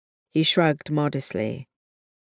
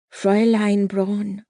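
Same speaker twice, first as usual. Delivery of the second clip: slow, higher, louder call of a German officer; German sergeant calling; 6 semitones higher, 4 dB louder than usual. A narrator's reading. German sergeant hails Catherine on the street, calling her name. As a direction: draw out the name as a German soldier.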